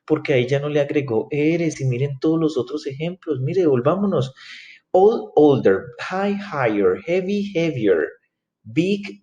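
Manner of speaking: high-pitched puzzled student calling to the teacher; protesting student. Acted. Student questioning teacher about the missing R, high-pitched protest. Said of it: "estén viendo el video y digan".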